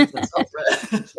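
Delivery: slow words breathed through a laugh. Real, not acted. Joking about self-preservation, laughing through the words.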